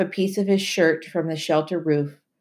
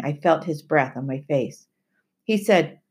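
ordinary delivery, nothing marked

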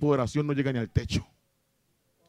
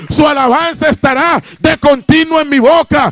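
loud, high-pitched preacher's shout; belted-out Pentecostal exhortation; full-force preaching; 12 semitones higher, 12 dB louder than usual. Acted. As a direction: cry out the praise to the whole congregation at full volume.

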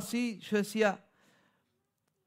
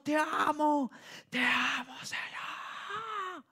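shouted worship cry; 7 semitones higher, 5 dB quieter, slower than usual. Retold or imitated. Pastor imitating the church shouting te amo. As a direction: call out to God like in worship. Congregation shouting love in song.

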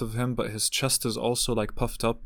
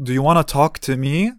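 louder, pointed ultimatum; firm retort; 3 semitones higher, 7 dB louder than usual. Retold or imitated. Telling the uncle to choose, firm and louder. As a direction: put him on the spot. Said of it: "then I told him".

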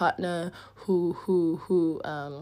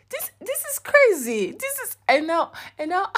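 excited disbelief; 11 semitones higher than usual. Real, not acted. Telling her co-host about her own story in high excited disbelief. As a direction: squeal it out in disbelief.

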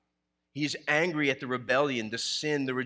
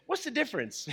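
son asking; 11 semitones higher, faster than usual. Retold or imitated. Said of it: "I think Daniel said".

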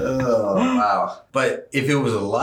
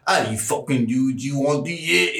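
higher, garbled British mumble; mock British accent; 5 semitones higher than usual. Cohost imitates people in the UK, garbled British accent. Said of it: "people in the U K are like".

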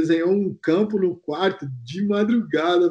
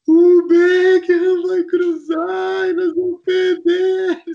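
high, slow, whimpering wail, much higher; imitating crying; 13 semitones higher, 5 dB louder, much slower than usual. Teammate at night fearing Beckham, mock sobbing high wail. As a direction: play the sobbing teammate, pitch way up and drag it.

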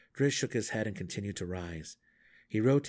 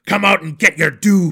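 loud, high battle taunt; angry challenge; 8 semitones higher, 18 dB louder than usual. A narrator's reading. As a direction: dare the monster to come out. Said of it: "Belwer fell into his chant".

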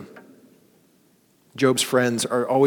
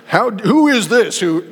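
loud, high, slow stern rebuke; mock stern rebuke; 8 semitones higher, 6 dB louder, slower than usual. Father to son, rejected stern rebuke take. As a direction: thunder it like an angry father. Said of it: "no, it wasn't that".

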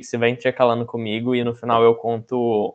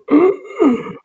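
gasp; 5 dB louder than usual. Retold or imitated. Retold loss of breath.

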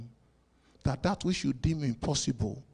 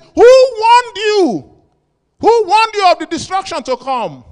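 much higher, louder, slow; thundering rebuke; 20 dB louder, slower than usual. Retold or imitated. John the Baptist rebuking the crowd, loud and high. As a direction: rattle them with it. Preaching peak.

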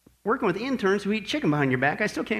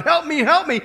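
crying out for help; 5 semitones higher than usual. Acted. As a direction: cry out like a planet begging for rescue. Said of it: "literally crying out".

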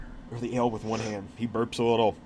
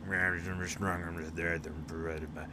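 mumbling carrot eater; 6 semitones lower, 9 dB quieter than usual. Acted. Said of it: "the guy who's eating the carrot was like".

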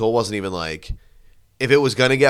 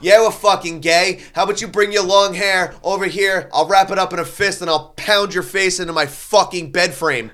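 raised-pitch, ranting taunt; defiant threat; 7 semitones higher than usual. Retold or imitated. Drunk heckler threatening the singer on stage, defiant rant. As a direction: escalate it into a boastful threat. Retold threat in bar quarrel.

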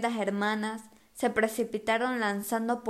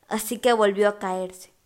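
usual speaking voice

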